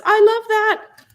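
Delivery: high, loud, slow squeal of delight; Jen's gushing reaction. Retold or imitated. Reading a viewer's comment as a high, loud, slow squeal. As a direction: gush over it like a thrilled fan. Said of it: "Jen says".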